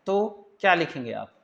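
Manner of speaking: usual speaking voice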